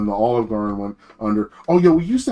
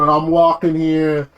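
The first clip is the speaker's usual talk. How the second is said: put-on slow Brooklyn Italian accent, chip on the shoulder; 4 semitones higher, 5 dB louder, slower than usual. Imitating tough Brooklyn Italians, Brooklyn accent. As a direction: lean into a tough Brooklyn attitude. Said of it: "tip of Brooklyn, nasal kinda, you know, Italian".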